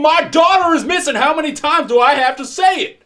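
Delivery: very high, loud furious screaming; full-volume angry shouting; irate father. Acted. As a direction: yell in desperate outrage at the officer. Irate father yells at officer.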